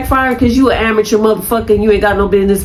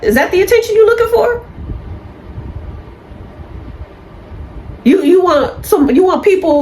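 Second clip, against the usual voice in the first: much higher, fast, mocking questions; exasperated rant at attention seekers; incredulous angry rant; 6 semitones higher than usual. Real, not acted. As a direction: hammer each question up high and fast. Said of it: "I'm pissed off".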